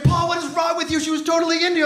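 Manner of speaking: exasperated roommate. Retold or imitated. Roommate yells at Paul after the store, mock exasperated. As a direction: scold him like a baffled buddy. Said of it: "my roommate's like".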